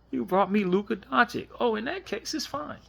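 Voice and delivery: hammed-up giddy fan voice; mock delighted surprise. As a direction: squeal it like a fan who just won. Mock reaction to getting Luka.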